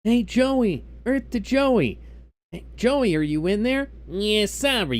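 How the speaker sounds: high-pitched mock Brooklyn accent from Yu-Gi-Oh! Abridged. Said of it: "Doing this Brooklyn accent".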